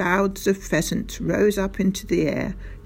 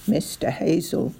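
plain reading voice

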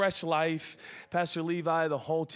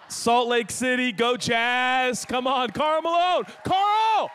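high, loud fan shout, rising to a yell; cheering; 8 semitones higher, 6 dB louder, slower than usual. Real, not acted. Preacher cheering the Jazz to Salt Lake, calling out loudly. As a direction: holler like a fan in the stands.

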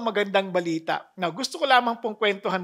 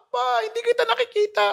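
put-on scared child; 3 dB louder, faster than usual. Child answering father.

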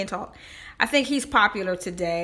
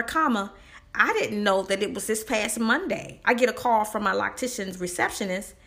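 ordinary talk, nothing marked

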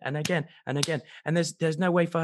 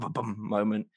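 ordinary talk, nothing marked

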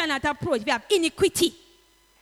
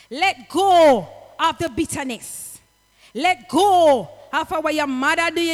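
louder preaching with a strong push on go; fervent preaching; 4 dB louder than usual. Acted. Preacher urging the flock to let go, fervent preaching. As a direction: urge them to let go, building.

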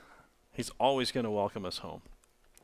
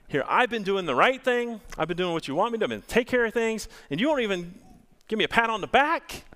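put-on indignant older son, rising higher and louder toward the end; older son's complaint; 7 semitones higher, 4 dB louder, faster than usual. Older brother protesting to his father, indignant and rising. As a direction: voice the older brother who thinks the party is unfair.

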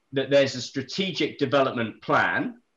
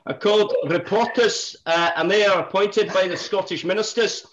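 high, loud put-on Scottish accent; 6 semitones higher, 4 dB louder than usual. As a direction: present the case in hammed-up Scottish. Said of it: "Do I do it in a Scottish accent".

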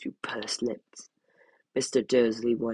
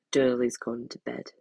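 ordinary delivery, nothing marked

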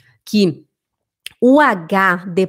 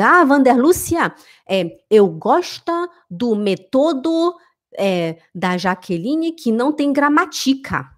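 slow German-speaker Portuguese with misplaced stresses; halting foreign-accented Portuguese, stresses in the wrong places; foreigner's mispronounced Portuguese; 3 semitones higher than usual. Acted. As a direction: imitate a Swiss speaker struggling in Portuguese. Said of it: "chegar um alemão ou um suíço e falar".

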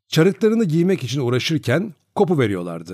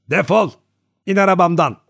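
higher, louder furious yell; boy yelling at coachman Piotr, higher and louder; shouting boy; 6 semitones higher, 5 dB louder than usual. A narrator's reading. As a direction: throw him out of the cart at full voice. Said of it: "diye bağırdım ben de ona".

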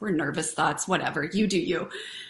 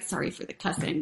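whispered aside; 7 semitones lower, 10 dB quieter, faster than usual. Real, not acted. Aside apology after cussing.

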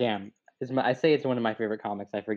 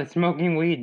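badly faked voice after a chuckle, pitched up; Seth Rogen; 6 semitones higher, 4 dB louder than usual. Practicing the impression for a friend, half-successful higher voice. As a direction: attempt a stoner comedian and miss. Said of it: "Seth Rogen's voice".

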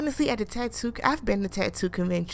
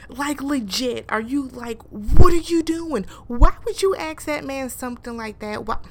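exasperated; 5 semitones higher, 8 dB louder than usual. Real, not acted. Outraged at Evanita.

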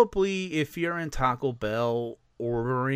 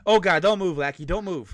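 urgent warning; 6 semitones higher, 6 dB louder than usual. Pretend. Warning friend about creature.

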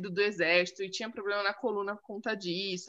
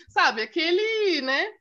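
normal talking voice

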